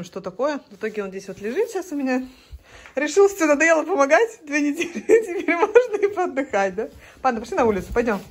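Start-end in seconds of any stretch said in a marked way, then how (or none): 3.0-6.7 laughing while teasing. Real, not acted. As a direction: laugh through the joke. Teasing about lazy husband.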